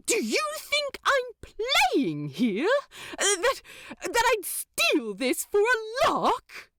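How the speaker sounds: an angel wounded by the accusation; hurt anger. A narrator's reading. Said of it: "Aziraphale's unexpected anger and distress".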